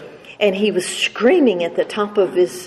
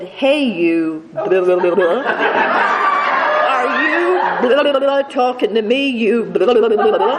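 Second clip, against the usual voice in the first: mock sassy backtalk; 3 semitones higher than usual. Child talks back to the nun.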